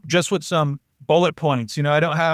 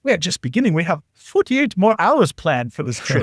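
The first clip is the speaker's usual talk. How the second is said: put-on German accent; 3 semitones higher than usual. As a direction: imitate a German friend, drawing it out. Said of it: "my German friend's like".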